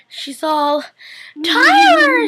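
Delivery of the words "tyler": high, loud mock scream of a name. Miss Lockwood watches her son turning, high loud scream. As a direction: scream for him like a terrified mother. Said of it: "She starts screaming".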